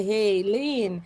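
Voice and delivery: ordinary talk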